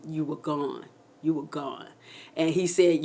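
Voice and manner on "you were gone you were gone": low and soft, sinking; subdued retold disbelief; grave, hushed quote. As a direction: say it quietly, as grim news. Quoting husband's grave account.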